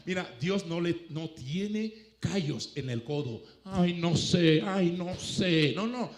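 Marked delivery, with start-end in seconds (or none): 0.1-3.4 slow emphatic line. Shown as a demonstration. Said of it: "Dios no tiene callos en los codos". 3.6-5.7 mock fretting sigh. Mock God fretting, elbows on table.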